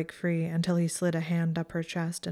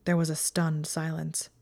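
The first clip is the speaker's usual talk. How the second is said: ordinary delivery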